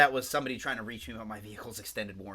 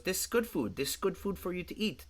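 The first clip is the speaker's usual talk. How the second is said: put-on lightly Indian-accented, higher doctor voice; Indian doctor impression; 6 semitones higher, 5 dB quieter than usual. Retelling TV doctor's reply to patient, light Indian accent. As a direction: imitate the Indian doctor, pitched up and calm. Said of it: "That's what he sounds like".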